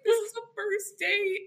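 high, pleading mock whimper; helpless little squeak; near tears. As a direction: retell it as if about to cry. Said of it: "on the near thing of tears".

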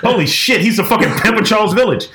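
retold amazement, high-pitched exclamation; high, astonished storytelling quote; astonished reaction. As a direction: re-enact an awestruck outburst, pitch jumping up.

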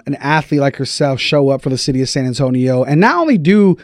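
usual speaking voice

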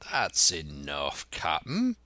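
drawn-out pirate call, jumping up at the end; jovial sea-dog holler; cheery shout. A narrator's reading. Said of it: "shouted Long John cheerily".

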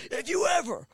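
high-pitched imitation of an outraged boss; hammed-up territorial warning; mock scolding threat. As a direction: warn them off in a put-on outraged voice. Booker's threat over his sign.

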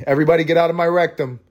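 high, quick call-out to everybody; mock announcement. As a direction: call it out high and fast, for laughs. Repeats line as loud joke.

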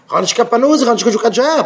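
high-pitched acted protest; mock complaint. Speaker mimics a jobless man making excuses, high mock complaint.

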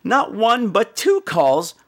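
ordinary delivery

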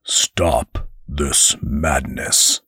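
low, very slow command; an angel orders his brother to stop; stern angel. Acted.

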